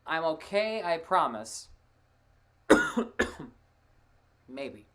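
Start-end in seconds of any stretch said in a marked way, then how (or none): 2.7-3.5 sudden harsh cough; coughing fit. Real, not acted. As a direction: cough attack breaking through. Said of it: "start having a cough attack".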